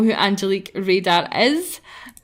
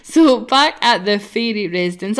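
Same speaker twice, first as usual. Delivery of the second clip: ordinary talk, nothing marked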